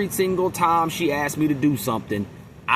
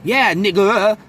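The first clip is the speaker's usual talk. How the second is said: put-on quivering Caucasian accent; 5 semitones higher, 5 dB louder, slower than usual. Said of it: "they voice quiver with the E R".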